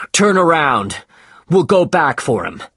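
loud order. Acted. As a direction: bark the order to turn back. Captain gives an order.

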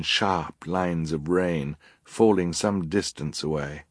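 ordinary delivery

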